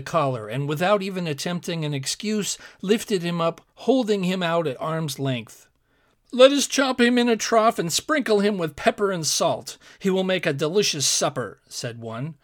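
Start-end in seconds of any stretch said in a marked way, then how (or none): 6.3-11.6 higher, louder giant with relish; gleeful hungry giant. A narrator's reading. Giant planning supper with his comrade, eager and raised. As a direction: propose the meal eagerly.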